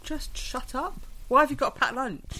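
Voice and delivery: high-pitched, mock-irritated complaint; exasperated. Theatregoer fed up with school groups, exasperated. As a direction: complain at them, pitch jumping up without shouting.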